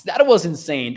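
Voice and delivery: ordinary talk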